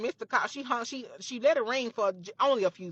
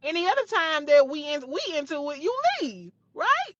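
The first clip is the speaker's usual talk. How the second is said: high, loud furious yelling, climbing higher; yelling higher and louder at the best friend on the phone; screaming on the phone; 8 semitones higher, 4 dB louder than usual. Retold or imitated. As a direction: scream it down the phone at the friend who betrayed you. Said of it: "we both screaming at the phone".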